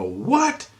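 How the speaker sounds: very high rising "what?"; acted bewildered disbelief; baffled question. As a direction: squeak it up in total confusion. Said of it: "I'm totally fucking confused".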